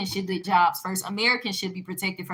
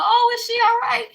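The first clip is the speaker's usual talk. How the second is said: high-pitched, louder mimicked worry; concerned classmates; 13 semitones higher, 6 dB louder than usual. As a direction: mimic fussing onlookers, pitched way up. Classmates' mock-concerned question.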